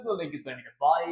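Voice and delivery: fast announcer patter; fast read. Acted. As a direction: rattle it off quickly.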